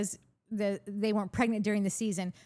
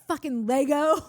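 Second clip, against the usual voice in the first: louder mock-exasperated outburst; irritated; 6 dB louder than usual. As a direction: imitate a fed-up insult, punched loud. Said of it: "got so irritated with him".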